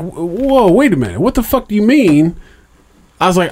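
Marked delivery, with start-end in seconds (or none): 0.0-2.4 higher, rising alarm on the whole question; retold disbelief. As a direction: replay your shock at her news, pitched up. Retelling his shocked reaction to his wife.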